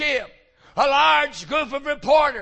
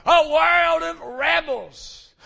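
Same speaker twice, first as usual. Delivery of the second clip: normal talking voice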